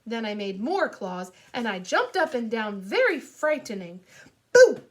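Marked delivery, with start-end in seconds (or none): 4.5-4.8 very loud, high boo; shouted. Acted. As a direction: shout it to make them jump. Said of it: "I shouted".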